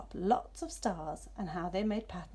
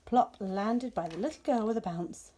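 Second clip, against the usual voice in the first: ordinary delivery, nothing marked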